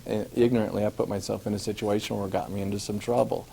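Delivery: ordinary talk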